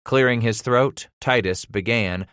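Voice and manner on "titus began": usual speaking voice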